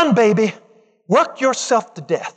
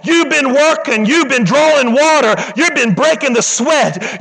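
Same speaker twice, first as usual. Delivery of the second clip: normal talking voice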